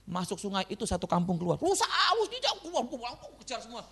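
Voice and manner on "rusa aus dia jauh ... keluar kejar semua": high, excited mock calling, fading away; imitated village clamour. Preacher retelling a village chasing a deer, excited mock calls. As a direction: mimic villagers crying out after a deer.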